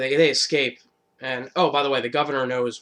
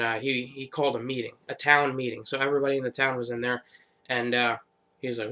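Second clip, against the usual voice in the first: ordinary talk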